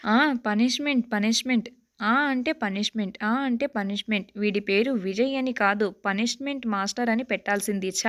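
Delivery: ordinary delivery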